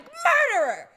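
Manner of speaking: high shrieked accusation; mock outrage. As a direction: shriek it in fake horror. Mock outrage at okra advice.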